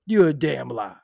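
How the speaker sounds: ordinary talk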